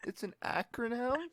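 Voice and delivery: imitated deflated bewilderment; crestfallen. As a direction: ask it small and lost, rising in doubt. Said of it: "I saw the light drain from her eyes".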